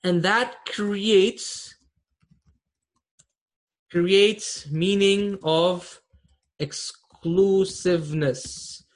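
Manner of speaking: very slow, word by word; slow teacher emphasis. Shown as a demonstration. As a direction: drag it out for the class. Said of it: "Put the related before the mubtada".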